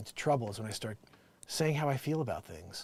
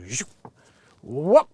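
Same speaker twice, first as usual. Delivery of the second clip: quick zoop and rising whoop; cartoon whoosh leaping up high on whoop; imitated falling noise; 3 semitones higher, 13 dB louder than usual. Streamer imitates a body falling, mock whooshing noises.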